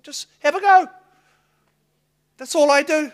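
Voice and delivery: loud, high urging cry; rally the church to just try; preaching shout. Real, not acted. Preacher to congregation, loud high urging cry.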